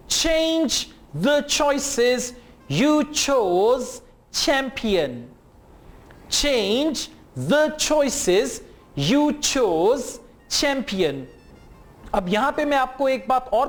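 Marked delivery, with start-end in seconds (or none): 0.1-5.3 every ch drawn out, very slow; slow model reading. Shown as a demonstration. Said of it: "Listen and repeat". 6.3-11.2 every ch drawn out, very slow; slow model reading. Shown as a demonstration. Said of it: "Listen and repeat".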